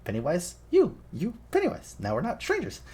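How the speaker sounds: high-pitched playful clown voice; Pennywise. Retold or imitated. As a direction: swoop up high, coaxing a child to trust you. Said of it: "he does the whole".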